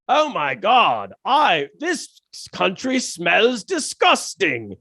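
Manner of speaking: put-on slow, lightly done British accent; a hint of an old British comic sneering. British expat comic mocking China to British crowd in a light British accent. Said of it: "shout out to your British accent".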